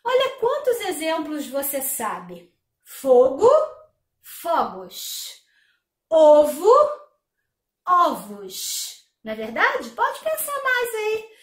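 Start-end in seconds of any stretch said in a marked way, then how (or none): 2.8-3.5 "Fogo" with closed first O, loud. Shown as a demonstration. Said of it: "O O deixa de ser um timbre fechado". 4.2-5.4 "fogos" with open first O, lower. Shown as a demonstration. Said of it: "no plural ... vai virar O". 6.1-6.7 "Ovo" with closed first O, loud. Shown as a demonstration. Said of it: "O O deixa de ser um timbre fechado". 7.9-9.1 "ovos" with open first O, lower. Shown as a demonstration. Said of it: "no plural ... vai virar O".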